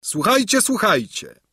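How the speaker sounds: high cry, falling on the repeat; herald's call. A narrator's reading. Herald begins his proclamation.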